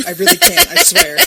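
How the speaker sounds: high, loud, laughing protest; Ed Harris refusing, loud laughing panic; panicked actor. Pretend. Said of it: "Ed Harris is standing in the background".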